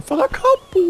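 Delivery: goofy call-out. Retold or imitated. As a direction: yell 'Pfarrer Capo' like the buddy, no lisp. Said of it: "kann man ja gar nicht lispeln".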